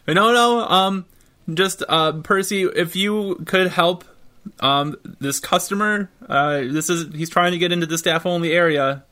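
nervous clerk voice. Acted. Clerk calls Percy for help.

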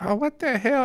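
high-pitched mock disbelief; mock shock. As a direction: squeal it high as the appalled shopper. Acting out the shocked lady.

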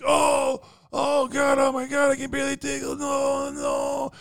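high, hammed-up moaning; injured man's wailing. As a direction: wail like a man hurt and panicking. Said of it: "and he's like".

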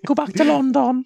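very high, loud whiny king voice; Henry the fourth voice. Acted. King complaining about the rain, very high whiny squeal. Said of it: "same Henry the fourth voice".

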